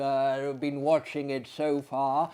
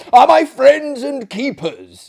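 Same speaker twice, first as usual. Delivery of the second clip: louder, higher stiff correction; drawn-up interruption; 10 semitones higher, 15 dB louder than usual. Acted. Maillard bristling at his guest, loud stiff. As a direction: cut in with wounded dignity. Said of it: "interrupted Monsieur Maillard, drawing himself up".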